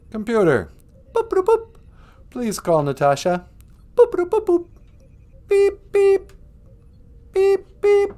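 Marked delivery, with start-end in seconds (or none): 1.1-1.7 very high mock computer beeps. Calling the spaceship computer with high mock beeps. 3.9-4.7 very high mock computer beeps. Calling the spaceship computer with high mock beeps. 5.5-8.1 very high mock ringtone beeps; phone ringing beeps. Calling Natasha with high mock ring beeps.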